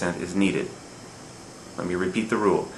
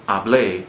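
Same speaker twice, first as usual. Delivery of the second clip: haBLÉ, weight on the end; final-syllable stress; 4 dB louder than usual. Shown as a demonstration. Teacher pronouncing it correctly, hablé stressed on BLÉ. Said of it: "stresses the final syllable".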